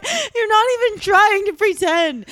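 put-on whiny teen outburst; exasperated teen snapping at dad; angry.

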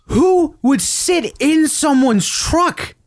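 very high, drawn-out incredulous question; exasperated disbelief. Real, not acted. Asking friends who would sit in someone's truck, high exasperation. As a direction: stretch every word in utter bafflement. Still baffled after telling the story.

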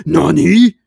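loud, rising shocked nani; startled anime nani. Retold or imitated. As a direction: blurt it out in shock. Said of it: "He's like".

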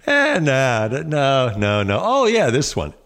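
high, dismissive nah then a bright pick; mock choosy star. Pretend star actor picking scripts, breezily dismissive. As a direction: play a spoiled actor tossing scripts aside.

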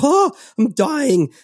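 high, slow hammed-up alarm; playful fake terror at a lab report; mock panic. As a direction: overplay a patient who thinks he's dying. Mock reaction to red lab marks.

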